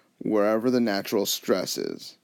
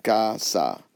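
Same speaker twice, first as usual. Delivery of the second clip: "casa" slowly broken into two syllables; split into syllables. Shown as a demonstration. Teacher showing how to divide a word, "casa" syllable by syllable. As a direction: say "casa" with a gap between the syllables. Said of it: "you would just divide it".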